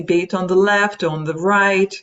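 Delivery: normal talking voice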